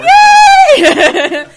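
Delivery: squealed high, loud cheer. Retold or imitated. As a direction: scream it with joy. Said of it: "We were like".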